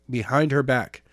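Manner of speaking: usual speaking voice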